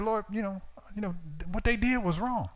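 high-pitched grievance brought to God; praying complaint. Retold or imitated. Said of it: "I'm just praying".

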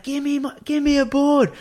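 high-pitched imitation of yelling out; re-enacted yelled demand; retold yelling. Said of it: "I start yelling out".

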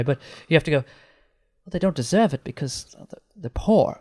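hammed-up British accent; mock British accent. Mocking those who say poor people don't deserve it, in exaggerated British accent. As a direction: put on a very British voice. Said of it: "with the most British accent you can".

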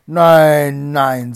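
explosive, very loud no; shouted refusal. A narrator's reading. Bluebeard refuses his wife's plea, shouting. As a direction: roar it out, cutting off the plea.

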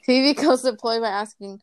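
ordinary talk, nothing marked

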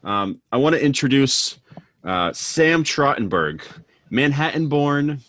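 bright, booming radio host. Acted. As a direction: announce it like a DJ. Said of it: "this is when the radio voice".

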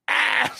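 high, loud hammed-up scream; mock scream. Reenacting the mannequin scene for friends, hammed-up scream. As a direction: shriek in fake terror.

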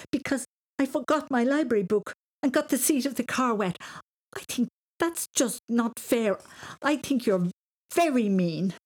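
put-on aggrieved boy protesting in a high voice; angry outburst. A narrator's reading. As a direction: rant indignantly, hitting the key words hard. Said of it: "he stamped his foot".

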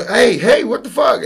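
high yelled alarm; shouting. Retold or imitated. Ashley yelling at the friend peeing on her, high and loud. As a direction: yell it like she just woke up.

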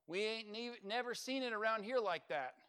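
hammed-up Oklahoma country twang; Oklahoma accent. Shown as a demonstration. Preacher retelling the crowd's words for the congregation, mock Oklahoma accent. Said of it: "I'll put it in Oklahoma language for you".

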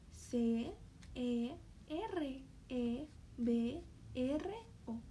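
put-on slow letter-by-letter for kids; slow spelling. Shown as a demonstration. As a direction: spell each letter slowly. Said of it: "Todos juntos".

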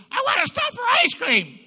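very high, very loud shriek; a hammed-up wailing demand; mock scream. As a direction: wail it out at top volume like the wife. Wife finally blurts her wish.

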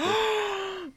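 sharp, high intake of breath; dramatic gasp. Pretend. About to address the streamer directly, dramatic gasp. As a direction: gasp theatrically before a big address. Opens mock plea to Hassan.

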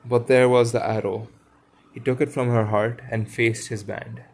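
clear, projected read-aloud with lightly acted dialogue; story narration. A narrator's reading. Reader telling of the boys and the fallen lady, storytelling voice.

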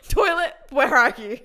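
loud, drawn-out comic plea; hammed-up slow, loud cry; desperate calling out. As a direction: call for it in mock desperation. Retold frantic search for toilet.

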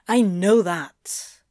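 slow, irritated snap, mildly acted; spat out. A narrator's reading. Crowley rebuffs his counterpart's reassurance, slow irritated spitting. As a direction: spit the words out one by one. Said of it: "Crowley spat".

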